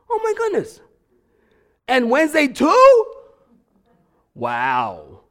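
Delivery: shrieking high disbelief; mock shock. Outsider mocking churchgoing habits with high mock shock. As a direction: gasp at it like it's scandalous.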